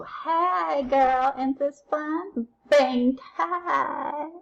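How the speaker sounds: put-on a kid delighted on a swing; high giddy playground voice; playful swing voice. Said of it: "picture yourself being on a swing".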